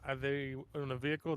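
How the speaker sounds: normal talking voice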